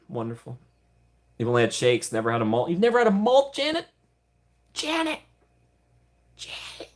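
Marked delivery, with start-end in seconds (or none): 0.1-0.6 softer, calmer take; quiet. Acted. 2.7-6.9 high, incredulous rising question; shocked disbelief. Real, not acted. Shocked at viewer never having malt.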